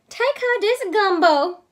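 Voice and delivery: high, loud Cajun mama; Mama's voice. Acted. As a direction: call it out like a bossy Cajun mother. Mama instructs her daughter.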